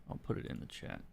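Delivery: whispered under the breath while typing; whispered to self. Real, not acted. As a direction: mutter it in a voiceless whisper. Muttering while typing to chat.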